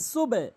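"সুবে" in two syllables, a short vowel released after b; open second syllable. Shown as a demonstration. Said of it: "এখানে সুবে বলতে হবে".

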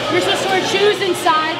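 ordinary talk

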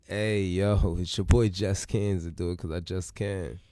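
loud, slow, low-pitched host tagline; hype self-introduction. Real, not acted. Co-host saying their catchphrase, hype intro style. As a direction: announce yourself big, stretching the words.

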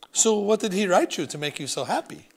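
a French grandmother gently prying; French accent. Acted. Grandmother asking what made grandson happy, French accent. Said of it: "Grandmere had a thick French accent".

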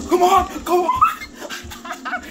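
high squealed urging breaking into laughter; overexcited. Real, not acted. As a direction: squeal it, giddy and carried away. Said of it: "I'm just getting overexcited".

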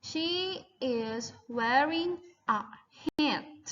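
"she is wearing a hat" stretched out, word by word; slow, clear model line; slow repeat-after-me. Shown as a demonstration. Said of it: "repeat after me". (พูดช้าๆ ชัดๆ ให้นักเรียนพูดตาม)

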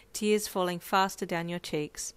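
plain reading voice

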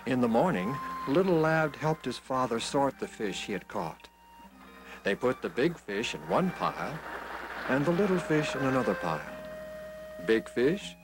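ordinary delivery, nothing marked

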